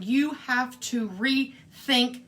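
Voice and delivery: slow, weighty mock ultimatum; stern God's warning. God or Mother Nature warning humankind, stern and emphatic. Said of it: "this is God or Mother Nature".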